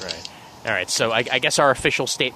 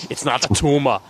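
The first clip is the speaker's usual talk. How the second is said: mock Arnold; 2 semitones higher than usual. Quoting Arnold line.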